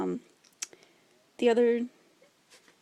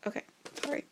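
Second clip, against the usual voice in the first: whisper; much faster than usual. Real, not acted. Embarrassed aside after quote.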